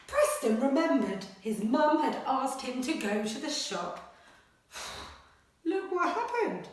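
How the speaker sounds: animated picture-book read-aloud; reading to children. Acted. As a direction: read it like a bedtime adventure. Teacher reads story aloud.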